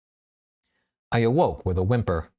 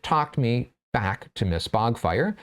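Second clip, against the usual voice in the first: usual speaking voice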